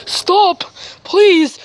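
high, loud begging cry; desperate plea. Acted. Survivor begging an attacker to stop, in a high terrified cry.

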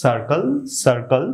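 "সার্কেল" said as sar-kel, repeated; two syllables, "sar" opening "সার্কেল"; correct circle pronunciation. Shown as a demonstration. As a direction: model "সার্কেল" with c as s. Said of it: "উচ্চারণ করতে হবে".

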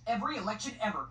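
usual speaking voice

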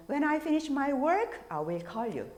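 slow "When I finish my work", then "I will call you"; slow model sentence; slow example. Shown as a demonstration. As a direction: read it out carefully. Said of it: "또는".

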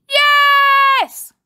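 high, loud shouted yes; crowd cheer. A narrator's reading. Pupils cheer back at their leader, shouting.

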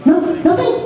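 high, breezy mock denial of any feeling. Church member brushing off a question, in mock breezy denial. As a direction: brush it off like nothing's wrong. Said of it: "they're talking like this".

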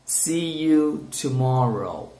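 slow, drawn-out "See you tomorrow"; slow model phrase. Shown as a demonstration. Teacher models "See you tomorrow" for students to repeat, slowly. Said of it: "Repeat, guys".

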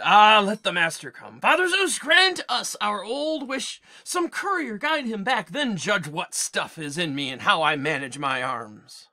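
loud eager herdsman calling on Zeus; an earnest servant's outburst, lightly voiced; cowherd's prayer. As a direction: cowherd swearing loyalty to the master.

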